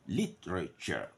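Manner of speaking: ordinary talk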